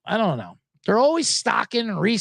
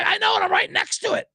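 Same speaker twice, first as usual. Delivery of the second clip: mock clerk; 13 semitones higher than usual. Clerk quoted, near the dip.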